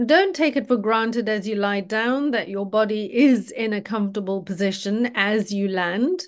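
usual speaking voice

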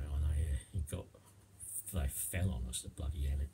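ordinary delivery

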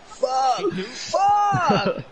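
high shouted curse, hammed up; mock commentator shout. Imitating a commentator screaming at the screen, high mock shout. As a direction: yell it like an excited commentator. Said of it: "A commentator going".

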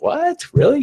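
high disbelieving squeak; mock shock, jumping way up on what; astonished what really; incredulous what. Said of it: "And we were like".

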